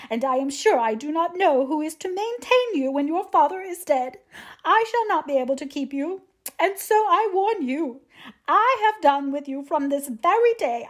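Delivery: high-pitched, aggrieved tirade; complaining mother. A narrator's reading. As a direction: scold and lament in a high, self-pitying voice. Narrator voices the complaining mother's tirade.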